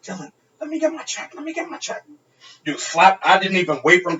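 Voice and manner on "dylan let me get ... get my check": high, hushed, hurried plea; retold panicked request to the bartender, high and hushed; urgent plea. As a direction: beg the bartender quietly for the check. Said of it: "I'm like".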